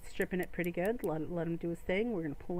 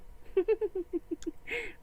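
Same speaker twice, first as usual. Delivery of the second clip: a real giggle, much higher; 12 semitones higher than usual. Real, not acted. Streamer giggling at his moo cow rod joke, high giggle. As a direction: burst into a high little laugh.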